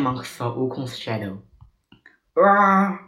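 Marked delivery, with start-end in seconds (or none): 2.4-3.0 loud, high "ah" of pain; high, drawn pained yell; cry of pain. A narrator's reading. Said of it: "cried Wukong".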